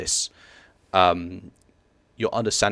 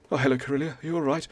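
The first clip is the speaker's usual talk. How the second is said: higher-pitched passing stranger asking after her; passerby greeting; 7 semitones higher, faster than usual. Acted. Passing Oseth greet Corellia, in a higher voice.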